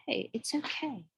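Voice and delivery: soft and kind, like soothing yourself; gentle self-comfort. Shown as a demonstration. Speaking to herself in the mirror, softly kind. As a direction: tell yourself gently that it is fine. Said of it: "to be nice to yourself and say".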